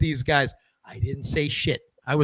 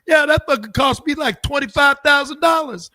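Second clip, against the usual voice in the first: high loud griping; 11 semitones higher, 7 dB louder than usual. Retold or imitated. [name] recalling a costly hit.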